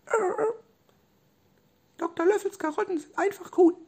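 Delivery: tiny, very high squeak; squeaky dolphin voice. Acted. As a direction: squeak like a small toy dolphin. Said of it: "guckt Robby an und macht". (hohe, piepsige Delfinstimme)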